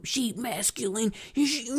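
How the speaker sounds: put-on voice of a judgy critic; mocking mimicry. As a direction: imitate someone scandalized, mockingly. Mimicking people who judge successful women.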